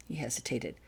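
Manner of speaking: usual speaking voice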